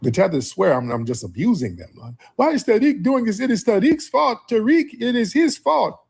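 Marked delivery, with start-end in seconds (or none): 2.3-6.0 much higher, whiny mock voice of the critics. Host mocking critics who blame Tariq, in a high whiny voice. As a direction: mimic the critics as whiny complainers.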